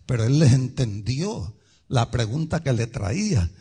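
ordinary talk, nothing marked

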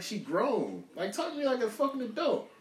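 usual speaking voice

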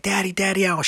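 dad mimics his five-year-old begging; imitating excited daughter. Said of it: "She's like".

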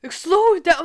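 a mock yell, high and loud; imitating dad yelling. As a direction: bark it like an annoyed dad. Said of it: "he just starts yelling".

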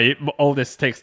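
ordinary delivery

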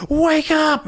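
high, loud imitation of a wife screaming; retold scream. Wife screaming at her unresponsive husband, high retold yell. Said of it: "and just screams at her husband".

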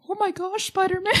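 squeaky high fan voice; high falsetto gasp. Retold or imitated. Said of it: "people were like".

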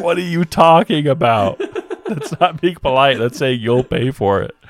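high, loud, laughing disbelief; incredulous retort. Retold or imitated. Narrator arguing back at his friend, loud laughing disbelief. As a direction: argue back in mock outrage.

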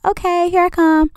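high sing-song answer; calling back. Retold or imitated. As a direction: call back brightly from the other room. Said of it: "I was like".

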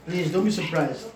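ordinary delivery

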